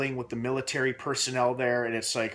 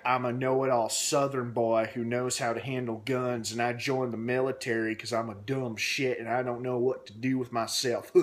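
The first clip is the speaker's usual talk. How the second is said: put-on strong Southern accent. Reviewer mocking the hated sergeant, strong Southern accent. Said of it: "he talked with a strong Southern accent".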